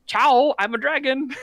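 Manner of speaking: lightly voiced dragon announcing itself; playful mock dragon. Joking host voices the dragon as it lands, playful mock dragon. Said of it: "the dragon was like".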